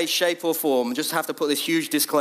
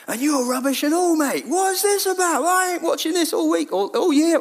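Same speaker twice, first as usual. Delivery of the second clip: higher, louder jeering football fan; a cheeky Londoner yelling at the manager; mock heckling fan; 10 semitones higher, 3 dB louder than usual. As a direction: bellow abuse from the stands, mate. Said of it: "all this kind of stuff".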